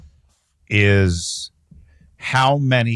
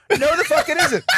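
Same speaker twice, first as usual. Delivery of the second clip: much higher, laughing disbelief; incredulous laughing retort. Retold or imitated. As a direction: scoff at him through a laugh. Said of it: "retold argument with a guy".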